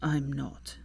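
just-woken rasp; Draco, groggy croak. A narrator's reading. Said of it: "Draco's groggy voice croaked".

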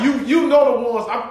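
ordinary delivery

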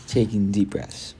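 usual speaking voice

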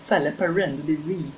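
ordinary talk, nothing marked

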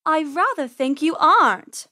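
menacing feline purr; purring cat. Acted. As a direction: purr a polite threat as a cat. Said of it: "Persephone purred".

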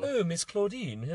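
high, slow posh British; hammed-up posh British, drawn out high; posh British accent. Teacher slipping while reading aloud, posh British accent. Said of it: "like, a posh British accent".

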